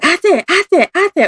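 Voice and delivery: put-on high, repeated child's cry of ate; a lost boy calling his sister over and over; kid calling. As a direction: pitch up like a scared small boy. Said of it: "he kept saying".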